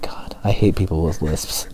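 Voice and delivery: slow, bitter whisper; angsty whisper. Retold or imitated. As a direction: grumble it like an angsty listener. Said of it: "Somebody's just really angsty out there".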